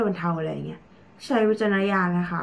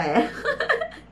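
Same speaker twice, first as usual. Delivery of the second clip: real laugh; 8 semitones higher than usual. Real, not acted. Laughs at not wanting to translate.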